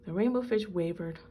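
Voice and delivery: usual speaking voice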